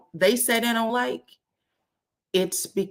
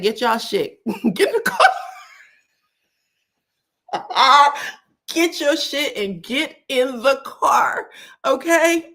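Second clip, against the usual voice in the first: higher, louder mock order through laughter; commanding mother; 6 semitones higher, 5 dB louder than usual. Mother ordering kids into the car, loud and laughing. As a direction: bark it like a fed-up mom.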